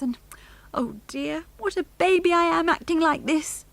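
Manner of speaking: high tearful voice; crying for joy. A narrator's reading. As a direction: chide yourself through happy tears. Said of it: "began to cry".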